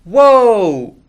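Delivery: long loud high amazed whoa, falling; a big amazed crowd whoa; crowd gasp. Shown as a demonstration. The crowd amazed by Zach's promise, loud whoa. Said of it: "You ready?".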